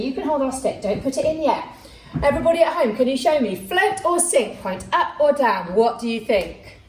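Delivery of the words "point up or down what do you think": usual speaking voice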